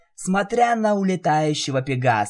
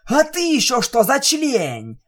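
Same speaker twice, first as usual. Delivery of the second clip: startled exclamation; 10 semitones higher than usual. Acted. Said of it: "воскликнул Сай".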